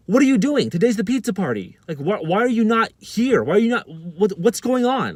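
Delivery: high, loud, angry questioning; retold yelling. Office lady berating the student missing the pizza party, yelling. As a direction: imitate her yelling, voice jumping up. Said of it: "she's yelling at me".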